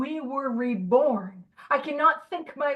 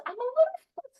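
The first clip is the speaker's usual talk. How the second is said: much higher, sing-song I'm a little frustrated; mock squeaky minimizing; 13 semitones higher than usual. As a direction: squeak it out innocently. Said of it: "it's just".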